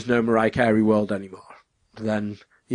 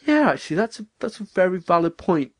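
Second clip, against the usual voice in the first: mock realisation; 7 semitones higher than usual. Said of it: "where I'm like".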